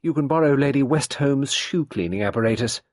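high, loud, cutting offer; unkindly. A narrator's reading. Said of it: "said Sarah unkindly".

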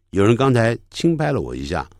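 normal talking voice

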